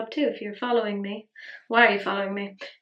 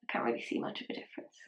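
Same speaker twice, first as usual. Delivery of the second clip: fast whisper; 12 dB quieter, much faster than usual. Real, not acted. Muttered aside, puzzled.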